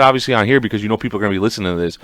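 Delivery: usual speaking voice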